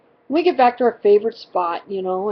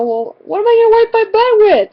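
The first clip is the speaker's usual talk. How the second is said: put-on high, loud complaining kid; retold indignant question, pitched way up; indignant whine; 11 semitones higher, 7 dB louder than usual. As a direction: mimic a put-out kid's protest.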